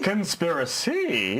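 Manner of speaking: slow, drawn-out questioning Conspiracy; questioning echo. Shown as a demonstration. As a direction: stretch CONSPIRACY out slowly as a question. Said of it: "making statements as questions".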